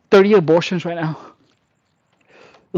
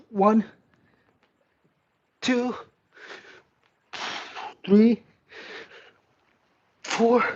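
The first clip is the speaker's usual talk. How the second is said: straining reps; 6 semitones higher, much slower than usual. Real, not acted. Fighting through a tough set, straining aloud. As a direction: squeeze each count out under strain.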